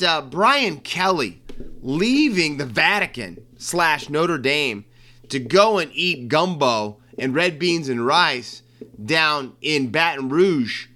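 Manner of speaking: ordinary delivery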